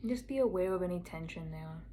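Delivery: soft meditation voice with long pauses; guided meditation. Acted. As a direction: gently guide a listener inward, unhurried and quiet.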